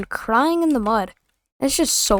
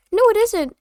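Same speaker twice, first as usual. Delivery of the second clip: put-on higher, snotty childish denial; mocking kid voice; 10 semitones higher than usual. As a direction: act the stubborn little kid. Kid denying the game's rules.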